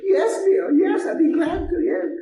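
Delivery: sweet husband. Retold or imitated. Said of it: "he goes".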